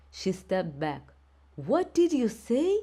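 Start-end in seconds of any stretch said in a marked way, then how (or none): 1.6-2.8 stunned outrage. A narrator's reading. Teacher stunned by refusal.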